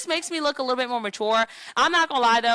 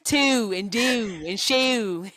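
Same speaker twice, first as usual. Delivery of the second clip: slow Baltimore accent on "Two", "do", "shoe"; 4 dB louder, much slower than usual. Shown as a demonstration. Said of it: "like the Baltimore people".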